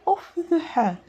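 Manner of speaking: high put-on tyrant queen voice; Queen of Hearts. Shown as a demonstration. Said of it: "I made my voice higher".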